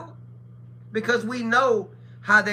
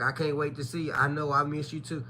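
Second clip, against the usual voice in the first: soft flirty caller; 6 semitones lower, 7 dB quieter than usual. Retold or imitated. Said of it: "I'm like".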